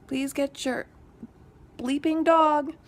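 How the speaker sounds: fed-up neighbor repeating a loud demand. Retold or imitated. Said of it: "By the second time I'd said it".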